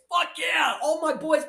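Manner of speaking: high celebratory shout; jubilant cheer. Real, not acted. Commentator cheering viewers' winnings, jubilant shout. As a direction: whoop it out high for the win.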